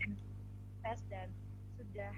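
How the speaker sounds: choppy cutting-out phone line; bad connection dropping out; breaking up space radio. Real, not acted. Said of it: "sebentar suaranya putus-putus".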